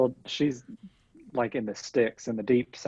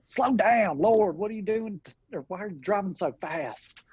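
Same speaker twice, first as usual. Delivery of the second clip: put-on Southern accent character voice; 8 semitones higher than usual. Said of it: "I just break into that voice".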